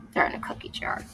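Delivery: hushed reveal; whisper. Real, not acted. Parent whispering the answer to child. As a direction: whisper the secret. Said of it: "hushed aside to child".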